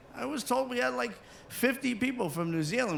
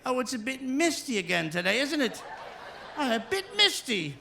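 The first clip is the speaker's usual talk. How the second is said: high, cheery mock English accent; 7 semitones higher than usual. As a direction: shrug off the rain politely in an English accent. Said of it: "English people, they go outside".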